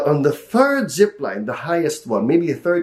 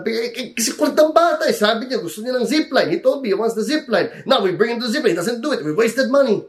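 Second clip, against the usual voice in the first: higher, whiny self-justification; self-justifying grumble; 9 semitones higher, faster than usual. Retold or imitated. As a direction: complain like a put-out dad. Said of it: "justify in my head".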